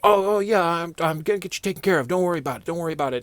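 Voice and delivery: mock eager boss promising; flustered boss. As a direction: play the boss brushing it off. Boss voiced reassuring.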